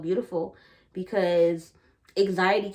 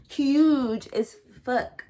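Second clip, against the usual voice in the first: slow emphasis; 5 semitones higher, 4 dB louder, much slower than usual. Real, not acted. Drawn-out emphatic boast.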